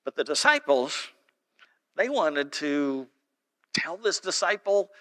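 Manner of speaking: ordinary talk, nothing marked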